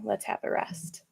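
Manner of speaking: soft, hushed aside; mock sponsor whisper. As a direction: whisper it like a little secret message.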